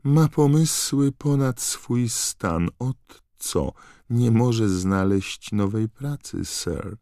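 high, slow disapproving elf voice; a house-elf grimly shaking her head; high and glum elf complaint; gloomy elf. Acted. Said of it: "powiedziała ponuro".

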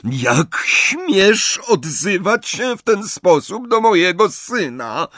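high, slow, outraged reproach; indignant cry. A narrator's reading. Narcyza defends her son to Bellatrix, indignant cry. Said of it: "zawołała Narcyza".

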